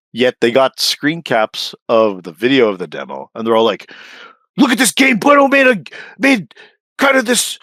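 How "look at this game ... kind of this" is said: high squeaky outrage; mock outraged fans. Mocking fans outraged at the game, high squeaky whine. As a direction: whine like a scandalized fan.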